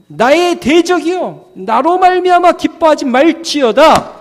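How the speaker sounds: high, declaimed scripture; confession of faith. Acted. Believer confronting the enemy in faith, declaiming. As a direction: proclaim it defiantly at a high pitch. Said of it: "이렇게 믿음으로 고백하는".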